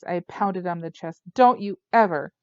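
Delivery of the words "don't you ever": louder, raw furious repeat; furious shout. A narrator's reading. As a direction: yell it as you pound his chest. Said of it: "I shouted in his face".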